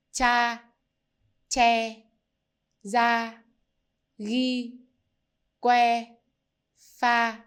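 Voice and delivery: each syllable said separately, clear and loud; drilling Vietnamese clusters. Shown as a demonstration. Teacher drills the cluster sounds for learners, slow and clear. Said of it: "One more time".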